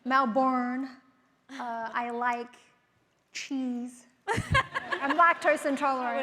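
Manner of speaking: Australian doing an American accent. Shown as a demonstration. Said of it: "hear your American accent".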